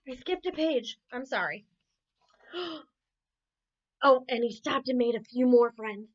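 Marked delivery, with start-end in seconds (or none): none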